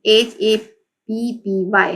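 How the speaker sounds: ordinary delivery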